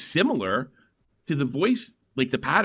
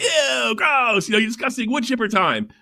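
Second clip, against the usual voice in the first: mock disgust; 10 semitones higher than usual. Stern's crew reacting to the guest, high mock disgust. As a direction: recoil in mock revulsion. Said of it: "everybody's like".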